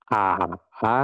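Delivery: A dipping down, then rising; falling-rising tone A. Shown as a demonstration. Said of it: "turun, setelah itu naik". (A turun, lalu naik)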